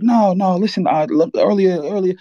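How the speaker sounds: retelling the moment the aunt says he's gone, frantic denial; panicked denial. Retold or imitated. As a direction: refuse the news, pleading in disbelief. Hearing his cousin has died.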